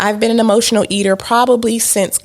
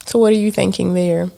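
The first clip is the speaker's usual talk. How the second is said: ordinary talk, nothing marked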